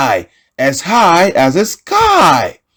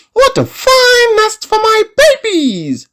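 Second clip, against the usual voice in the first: very high, tiny rabbit voice. Acted. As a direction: squeak it high like a little rabbit. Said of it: "said the rabbit".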